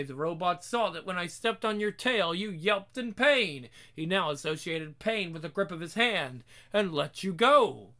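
normal talking voice

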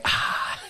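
breath-only satisfied exhale; airy post-drink "aah"; refreshed "ah" after a drink. Shown as a demonstration. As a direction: imitate the sigh after a long swig. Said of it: "someone drinking something and then going".

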